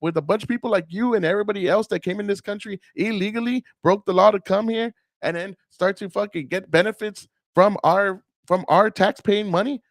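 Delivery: worked-up scolding; angry retort. Acted. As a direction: tell her off heatedly. Acted angry retort to the woman.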